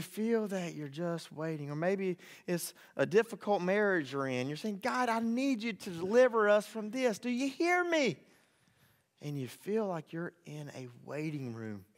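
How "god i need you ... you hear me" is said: high-pitched appeal to God; desperate plea. Acted. Spouse begging God to deliver the marriage, high and desperate. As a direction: plead upward, rising higher at the end.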